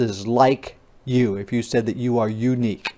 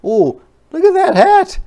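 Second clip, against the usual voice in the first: excited; 6 dB louder, much faster than usual. Acted. Excited child voice over hat.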